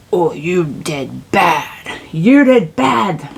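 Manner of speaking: mock a judgmental God, stretching every word; punishing God. Reader parodying a God who punishes, drawn-out scolding. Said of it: "It's not like God's like".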